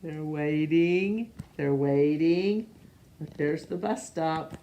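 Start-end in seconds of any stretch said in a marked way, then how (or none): none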